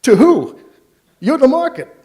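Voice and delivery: hammed-up stockbroker squeaking out the punchline; sheepish punchline. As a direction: deliver it like a caught-out broker, giggling. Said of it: "sheepish laugh and goes".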